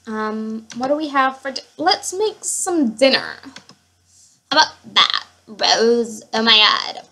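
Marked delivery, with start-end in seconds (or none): 4.5-7.0 talking in an odd, unintended voice; slightly louder, odd-sounding delivery. Real, not acted. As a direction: slip into a slightly strange way of talking. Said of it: "Why am I talking like this".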